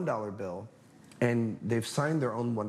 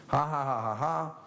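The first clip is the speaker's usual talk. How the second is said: mock laugh; 4 semitones higher than usual. Magician teasing customer over tip.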